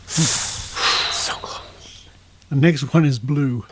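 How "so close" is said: breathy near-miss whisper, under the breath; hushed groan at a near miss; whispered so close. Real, not acted. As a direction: let it slip out as a disappointed whisper. Dice roll just missed.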